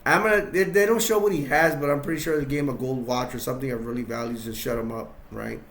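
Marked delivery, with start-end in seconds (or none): none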